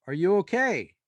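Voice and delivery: mock shout up the stairs; retold yell. Friend shouting up from downstairs, mildly loud. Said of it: "I hear him yell from downstairs".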